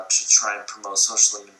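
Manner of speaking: usual speaking voice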